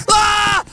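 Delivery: short loud startled cry; startled yell. Pretend. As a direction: yelp it out in fright. Spots the robber's van.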